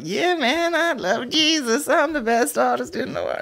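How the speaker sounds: very high, squeaky mocking voice; hammed-up falsetto parody of a devout star; mock born-again Jesus fan. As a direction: gush it in a silly falsetto, drawn out. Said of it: "He's like".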